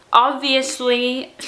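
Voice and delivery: slow, stiff-jawed "Obviously"; mushy consonants from a swollen jaw; with a sore jaw. Real, not acted. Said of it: "do you hear that?".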